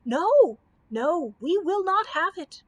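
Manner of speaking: high loud shriek. A narrator's reading. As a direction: cry out, refusing. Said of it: "shrieked the people".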